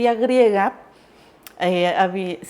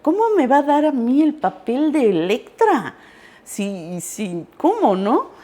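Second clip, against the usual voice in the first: mild mock disbelief; a touch of sarcastic disbelief; 5 semitones higher than usual. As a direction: scoff at the idea. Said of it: "se sorprendieron todos, hasta yo".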